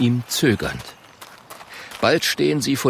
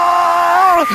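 long high-pitched yell of fright; terrified scream; 8 dB louder than usual. A narrator's reading. Klonk is grabbed by the bear, screaming. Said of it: "schreit Klonk".

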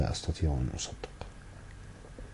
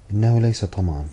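a read-out cry in protest, higher and louder; shouting; 4 semitones higher, 6 dB louder than usual. A narrator's reading. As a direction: throw the denial out loud. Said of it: "وصاحت فوزية".

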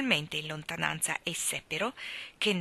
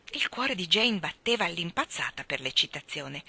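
usual speaking voice

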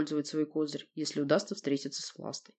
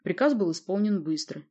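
usual speaking voice

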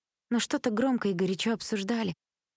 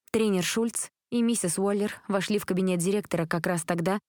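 ordinary delivery, nothing marked

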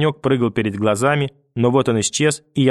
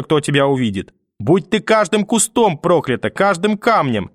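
put-on high, strident old woman's tirade; grandmother cursing; 6 semitones higher than usual. Grandmother curses the mother over the sick boy, shrill and raised. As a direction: hurl curses at her daughter.

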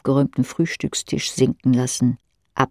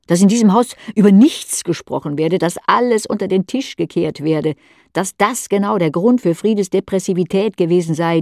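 rising, louder, pressing on; a mother reliving her son's outburst, agitated and louder; agitated retelling; 4 semitones higher, 3 dB louder than usual. Acted. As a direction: spill it out faster and hotter, upset.

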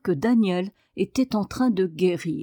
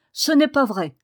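emphatic, higher and louder; energetic, punched-out rebuttal; energetic denial; 5 semitones higher, 5 dB louder than usual. A narrator's reading. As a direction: deny it with real force. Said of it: "Il dit cela avec une telle énergie".

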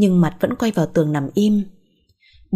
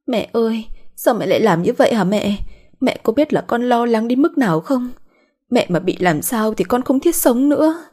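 higher-pitched, acted tearful plea to mum; 5 semitones higher than usual. A narrator's reading. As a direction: plead with mum as if crying, pitch lifted. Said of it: "ôm lấy bà khóc". (giọng mếu máo, cao hơn)

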